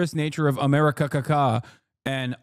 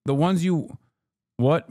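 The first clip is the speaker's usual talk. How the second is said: usual speaking voice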